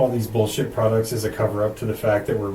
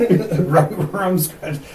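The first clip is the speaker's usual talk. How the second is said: slow, higher mock-scared voice; spooked ghost hunter; 7 semitones higher, 4 dB louder, much slower than usual. Replaying spooky video scene.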